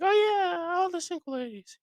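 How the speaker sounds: very high falsetto squeal; mock fan cooing a pop lyric; casual fan singalong. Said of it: "more of the guy that's like".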